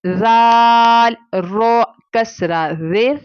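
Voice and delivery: रो with a thin, light r, drawn out slowly; slow Quranic spelling with a thin r. Shown as a demonstration. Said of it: "रॉ को फिर बारीक पढ़ेंगे".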